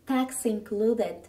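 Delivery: slow, deliberate English for learners; slow model phrase. Shown as a demonstration. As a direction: spell it out slowly for the class. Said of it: "消費税が含まれています。". (ゆっくりした見本の発音)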